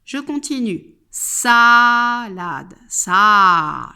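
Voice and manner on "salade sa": "SAAA-lade, SAAA", loud long A; stressed vowel. Shown as a demonstration. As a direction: stretch the A of "Sa" loudly. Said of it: "J'entends le phonème A".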